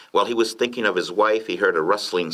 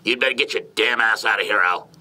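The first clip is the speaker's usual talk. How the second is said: higher, irritated warning; angry order; 3 semitones higher than usual. A narrator's reading. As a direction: order the intruder off your porch. Threatened man orders stranger away.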